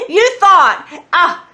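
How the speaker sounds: high, loud mocking burst with laughter; derisive laughing. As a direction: scoff out loud at the foe, laughing. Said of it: "despises you and laughs at you".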